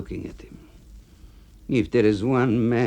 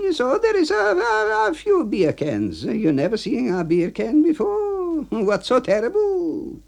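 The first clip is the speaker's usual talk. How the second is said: much higher voice, shrugging it off; dismissive A B; 3 dB louder than usual. Acted. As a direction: brush off the complaint.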